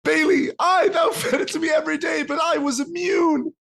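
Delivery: high, shouted theatrical lament; hammy anguished shout. Acted. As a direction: rail at the betrayer. Gnome reveals betrayal, hammy.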